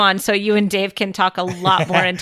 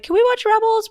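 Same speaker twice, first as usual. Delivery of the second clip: put-on high pleading kid asking; mimicking the kids' pleading ask. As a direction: imitate a child begging to watch. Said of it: "the kids are always like".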